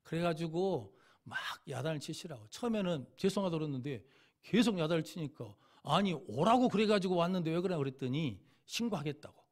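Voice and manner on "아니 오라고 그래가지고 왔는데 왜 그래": retold protest. Arguing back with scolding guard.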